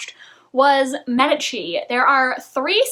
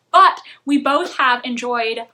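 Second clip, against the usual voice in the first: ordinary talk, nothing marked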